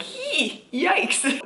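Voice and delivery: squeaky hee, then yikes; high mock wince. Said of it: "I'm like".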